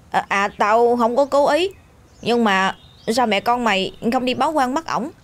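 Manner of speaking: put-on a flustered kid fumbling his words; awkward boy Tấn. Tấn awkwardly asks a question.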